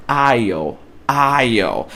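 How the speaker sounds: aisle said like "I'll", silent s, low falling; aisle, no s sound at all; correct English pronunciation. Shown as a demonstration. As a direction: say aisle as one syllable, ail. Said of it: "repetir em voz alta".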